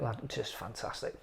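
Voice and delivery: ordinary talk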